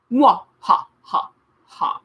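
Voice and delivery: cackling villain's laugh fading to a breathy hiss; villainous laugh. Acted. The Trivium gloating over the travellers, villainous laugh. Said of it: "a villainous laugh".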